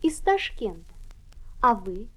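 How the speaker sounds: ordinary talk, nothing marked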